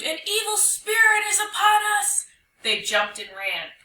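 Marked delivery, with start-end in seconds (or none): 0.0-2.3 high-pitched, loud panicked cry; terrified scream. Acted. Animals warning each other of an evil spirit, screaming. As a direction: sound the alarm at full pitch. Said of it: "the animals screamed".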